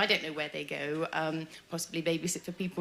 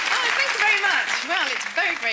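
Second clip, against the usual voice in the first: high, loud gush of thanks; high-pitched, thrilled stage entrance; excited greeting; 11 semitones higher, 9 dB louder than usual. Acted. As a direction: gush to the crowd over their applause. Comedian greets applauding audience.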